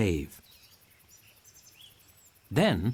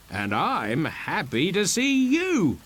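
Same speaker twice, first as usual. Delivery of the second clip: slow, hearty greeting; Stefano; 6 semitones higher, 3 dB louder than usual. A narrator's reading. Stefano greeting Trinculo back slowly and heartily. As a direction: warm it up, drawn out. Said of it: "says Stefano".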